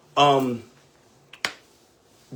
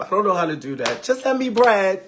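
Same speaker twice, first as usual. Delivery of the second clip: mock helpless friend; 6 semitones higher, 4 dB louder, faster than usual. Mocking helpless friends asking money.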